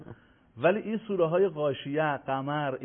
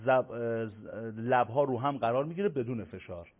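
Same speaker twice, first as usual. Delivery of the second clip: usual speaking voice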